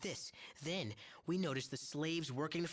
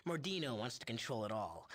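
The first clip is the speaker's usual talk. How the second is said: normal talking voice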